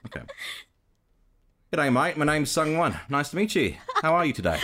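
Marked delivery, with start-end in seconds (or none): 1.7-4.6 higher-pitched, earnest Australian greeting; sincere attempt at an Australian accent. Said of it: "let's try my earnest".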